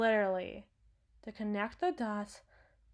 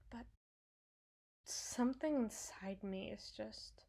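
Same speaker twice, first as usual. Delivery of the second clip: quiet, halting and half-whispered; hushed confession; 7 dB quieter than usual. Acted. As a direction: let it slip out softly, with long hesitations. Hesitant confession of unsettling feelings.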